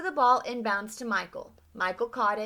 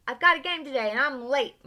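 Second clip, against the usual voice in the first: yelling back; 4 semitones higher, 6 dB louder than usual. A narrator's reading. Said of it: "Michael yelled back".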